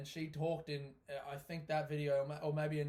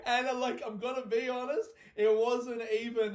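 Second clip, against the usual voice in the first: laughing confession; 7 semitones higher, 8 dB louder than usual. Real, not acted. Still laughing while confessing.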